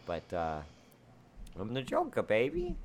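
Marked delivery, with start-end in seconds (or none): 1.6-2.8 hammed-up Joker catchphrase; Joker impression. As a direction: jump very high on baby. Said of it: "my Joker audition".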